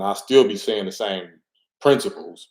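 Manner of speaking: usual speaking voice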